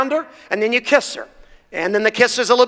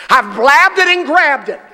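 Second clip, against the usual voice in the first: slow, louder mocking singsong; mock boast; 4 semitones higher, 5 dB louder than usual. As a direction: poke fun at the slogan. Mocking student's claim.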